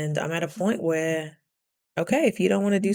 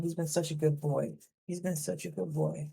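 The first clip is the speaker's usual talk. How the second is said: hushed fond aside; soft praise; 8 dB quieter than usual. Real, not acted. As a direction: praise a pet softly, almost to yourself. Praises pet while petting it.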